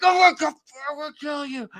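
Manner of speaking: high-pitched imitation of an enraged threat; mock threat. Angry stranger threatening the sign holder, mock enraged. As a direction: start loud, then drop off while staying high. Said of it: "They're about ready to kill me".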